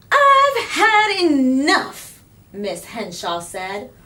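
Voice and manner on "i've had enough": high, loud and slow, fed up; exasperated teacher at the end of her rope; sharp teacher outburst; ruffled teacher. A narrator's reading.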